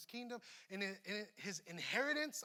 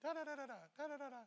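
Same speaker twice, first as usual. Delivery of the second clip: imitating wife's chatter; 7 semitones higher, much faster than usual. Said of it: "But example".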